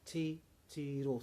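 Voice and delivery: "Titiro" slowly broken up as ti-ti-ro; syllable by syllable. Shown as a demonstration. Teacher showing the word has three syllables, slow split "Titiro". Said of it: "three syllables".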